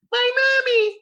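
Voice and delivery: put-on very high, loud child wail; hammed-up squealing child; imitated little child. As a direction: cry out like a little kid for mother. Voices little Susie seeing mom fall.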